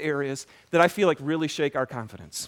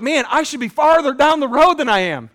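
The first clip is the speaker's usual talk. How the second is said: loud, high-pitched self-reproach; frustrated outburst; 8 semitones higher, 9 dB louder than usual. Acted. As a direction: raise the voice in frustration.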